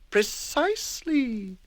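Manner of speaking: knowing agreement; fussy savoured word; slow high prim voice. Acted. Mister Tidy agrees.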